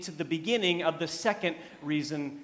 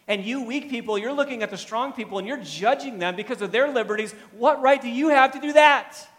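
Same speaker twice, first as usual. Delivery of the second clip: raised, loud rebuke building to a shout; confronting; 7 semitones higher, 10 dB louder than usual. Acted. Paul rounding on the weak believers, loud confrontation. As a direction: scold them hard, higher and louder to the last question. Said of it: "He confronts them".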